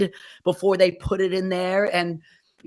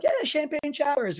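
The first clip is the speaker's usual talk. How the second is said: mock cheer, higher pitch without extra volume; excited groom; 9 semitones higher than usual. Retelling a guy calling for champagne showers, mock excited cheer. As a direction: lightly imitate a thrilled reveler's high cheer.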